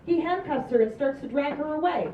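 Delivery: normal talking voice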